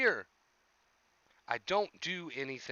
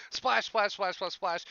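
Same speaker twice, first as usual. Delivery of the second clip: playful splash sounds; 4 semitones higher, slower than usual. Pretend. Showing chat how people stir water, playful splashes. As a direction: mimic hands slapping the water.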